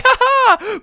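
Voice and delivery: high, loud carefree cackle; gleeful laugh. Retold or imitated. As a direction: laugh like a kid having fun. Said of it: "to them it's just like".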